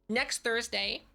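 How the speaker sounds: usual speaking voice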